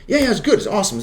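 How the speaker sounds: high-pitched, breezy reassurance; mock upbeat approval. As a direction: imitate an enthusiastic crew member signing off. Acting out crew's quick approval.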